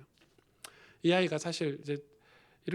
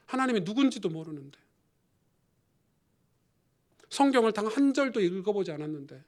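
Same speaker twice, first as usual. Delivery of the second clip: rising preaching force; 6 semitones higher, 6 dB louder than usual. Real, not acted.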